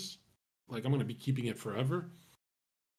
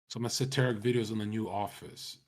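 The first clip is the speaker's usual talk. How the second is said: normal talking voice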